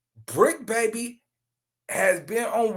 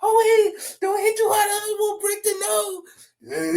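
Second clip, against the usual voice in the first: high, half-sobbing mock plea; mock crying woman; 13 semitones higher, slower than usual. Host imitating the woman pleading, high mock sob. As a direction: ham up a begging woman, whining high.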